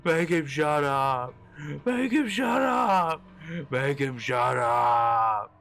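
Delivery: long, strained high screams; frantic screaming. A narrator's reading. Hutch snapping in the back seat, screaming frantically. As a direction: scream each phrase, stretching every word. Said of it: "finally snapped and started screaming".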